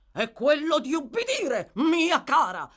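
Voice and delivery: loud, booming command; thundering. A narrator's reading. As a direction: thunder it down at her. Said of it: "tuonò il signor Bumble".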